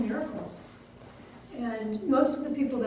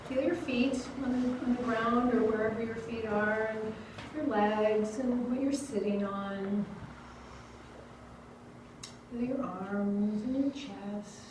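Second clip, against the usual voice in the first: unhurried, lowered voice, easing them inward; guided body scan; 2 semitones lower, 5 dB quieter than usual. Acted. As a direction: lead them gently through the body, pausing between parts.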